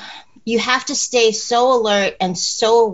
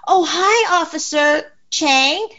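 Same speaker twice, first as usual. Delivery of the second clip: bright high "Oh, hi", then slow; fake friendly greeting; 6 semitones higher, slower than usual. Shown as a demonstration. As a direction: call out cheerfully to a fake officer. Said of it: "point behind the attacker and say".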